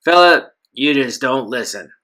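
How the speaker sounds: gravelly thug. Acted. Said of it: "Gravelly Voice".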